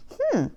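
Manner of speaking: high, soft questioning hmm; pondering hmm. Retold or imitated. Sniffing the fragrance in the store, high doubtful hmm. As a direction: sniff and consider it.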